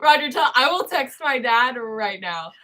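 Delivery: ordinary delivery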